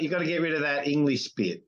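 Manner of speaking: usual speaking voice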